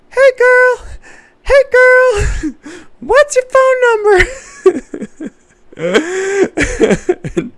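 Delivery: put-on very high, loud squeaky kid calling out; little boy shouting at girls from his toy car; little kid hollering.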